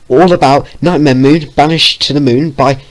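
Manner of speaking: excited. Acted. Vio repeating the phrase.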